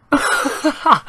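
high laughing fit; real laugh. Real, not acted. Gamer after his dodgiest goal, high helpless laugh. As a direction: crack up in bursts.

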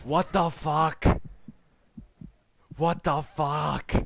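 exasperated shout. Real, not acted. Player furious at hitbox, slow exasperated shout. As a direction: bellow each word slowly in disbelief.

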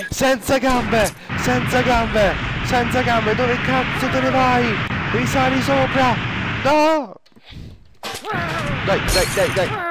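high frustrated yelling; explosive italian cursing. Real, not acted. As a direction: rage at the screen. Yelling at game.

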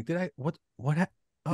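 confused moviegoer. Retold or imitated. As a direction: act out someone baffled after a film. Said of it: "you walked out going".

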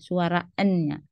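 ordinary talk, nothing marked

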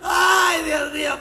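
high, loud cry of "Ay"; high, loud "Ay, Dios mío"; loud exclamation. Real, not acted. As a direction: cry out to the heavens. Exclaiming loudly.

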